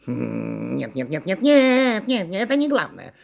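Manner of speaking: repeated no, climbing high; chattering monkey objection; interrupting monkey. A narrator's reading. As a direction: butt in as a fussy monkey, building to a squeal. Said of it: "вмешалась обезьяна".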